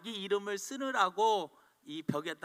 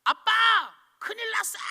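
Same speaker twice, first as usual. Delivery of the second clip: put-on high child's alarm call to dad; daughter crying out; 12 semitones higher, 7 dB louder, faster than usual. Daughter calls her father in alarm, high and urgent. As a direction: cry out to dad like a startled little girl. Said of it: "큰딸이 소리를 칩니다".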